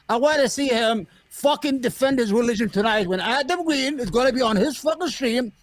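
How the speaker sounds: usual speaking voice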